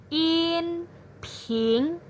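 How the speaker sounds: inping said high and level; inping on a high even pitch; first tone name. Shown as a demonstration. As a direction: say inping high and flat, held steady. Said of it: "nada pertama ini disebut juga dengan".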